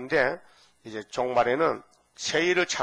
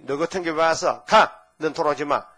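angry scolding; 3 semitones higher, 6 dB louder than usual. Pretend. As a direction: spit out the rejection. Acted scolding of returner. (화내며 쫓아내기)

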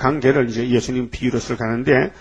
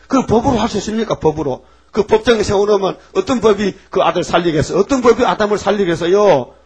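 high, loud, quick rhetorical preaching; rising preaching; 6 semitones higher, 4 dB louder than usual. Acted. Preacher challenges the congregation, high and forceful. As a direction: press the point home to the pews.

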